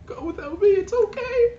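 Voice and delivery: high, tearful farewell; crying wife. Acted. Crying wife urges her husband to leave, high and tearful. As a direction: cry and send him away. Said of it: "She's like".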